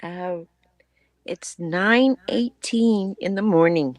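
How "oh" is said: usual speaking voice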